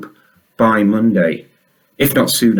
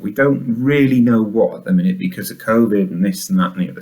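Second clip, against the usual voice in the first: normal talking voice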